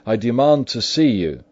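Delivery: high, loud, commanding cry; loud demand. A narrator's reading. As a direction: demand it forcefully through a closed door. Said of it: "cried Utterson with a loud voice".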